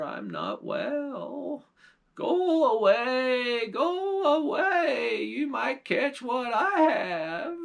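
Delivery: put-on high, slow, wailing old witch; a sly old witch faking sickness at the door, high and drawn out.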